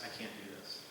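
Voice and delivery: soft, muted quote of her hesitation; retold quiet line. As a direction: say it hushed and unsure, as she did. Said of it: "she just gets real quiet".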